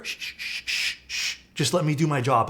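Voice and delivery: a string of sharp shushes, then a request; shushing a customer. Retold or imitated. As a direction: shush them mid-complaint, imitating a fed-up clerk. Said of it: "seen anyone get shushed".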